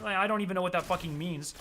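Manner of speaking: usual speaking voice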